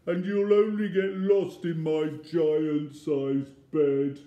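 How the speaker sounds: loud, full-voiced wail; big booming storybook complaint; bawling. A narrator's reading. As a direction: keep bawling it out. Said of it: "hear the voice bawl".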